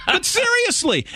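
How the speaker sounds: high, laughing burst breaking into speech; laughing at his own joke, then pressing on; real laugh. Real, not acted. Co-host laughing at his own impeachment joke, then a laugh. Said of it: "laughs at own remark".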